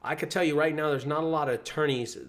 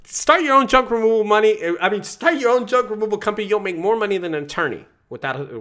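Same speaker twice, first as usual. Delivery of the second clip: loud, higher boastful shout; cocky shout; 7 semitones higher, 8 dB louder than usual. Retold or imitated. Vlogger mocking his old cocky self, loud brag. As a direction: brag it loudly like a cocky know-it-all. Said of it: "I used to say".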